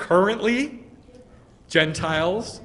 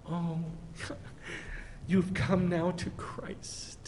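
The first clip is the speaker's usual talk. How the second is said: sugary mock gentleness; 9 dB quieter, slower than usual. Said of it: "he said something different".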